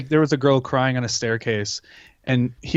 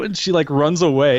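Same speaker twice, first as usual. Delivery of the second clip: ordinary talk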